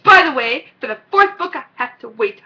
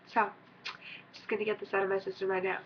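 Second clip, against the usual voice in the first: ordinary talk, nothing marked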